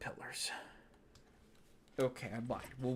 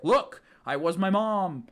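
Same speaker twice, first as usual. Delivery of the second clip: higher and louder excited reveal; a mock-proud reveal, raised and loud; mock Steven line; 4 semitones higher, 6 dB louder, slower than usual. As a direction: announce it like a big reveal. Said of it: "being like".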